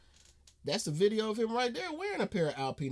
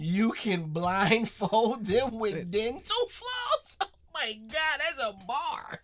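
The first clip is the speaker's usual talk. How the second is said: amazed; 6 semitones higher, 4 dB louder than usual. Real, not acted. Reacting with amazement.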